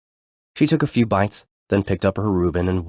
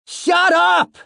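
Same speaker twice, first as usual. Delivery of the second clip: sudden high furious shout; yelling; 6 dB louder than usual. A narrator's reading. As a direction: snap it out in a burst of anger. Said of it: "Alex yelled".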